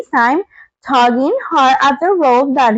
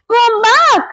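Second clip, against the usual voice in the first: scream; 9 semitones higher than usual. Acted. Dorothy calling to the rising balloon, high pleading cry. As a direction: call desperately after him. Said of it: "she screamed".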